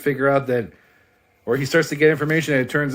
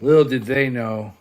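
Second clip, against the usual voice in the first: ordinary delivery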